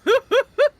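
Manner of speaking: loud, high gasp of delight; excited gasp. Real, not acted. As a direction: gasp at the dragon flyby. Dragon flies past.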